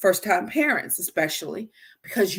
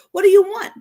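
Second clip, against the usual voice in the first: put-on a fed-up parent pressing a whining child; exasperated parent; 12 semitones higher, 9 dB louder than usual.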